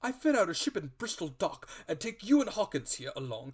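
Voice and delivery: high, loud and rushing; the squire excitedly promises a treasure voyage, loud and fast. A narrator's reading. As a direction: rattle off the plan in a high, loud rush.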